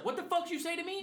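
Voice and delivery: high, mock-outraged challenge; angry customer. Angry customer squaring up to the bartender, mock outrage. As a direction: imitate an irate man demanding a repeat.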